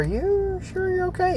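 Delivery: high princess voice. Retold or imitated. Princess asking her bunny if he is okay, high and sweet. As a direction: play a sweet young princess checking in. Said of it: "Sophia goes".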